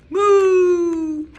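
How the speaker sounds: loud high mooing cow; big cow moo. Acted. Librarian reading aloud booms the cows' moo. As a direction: belt out a cow's moo.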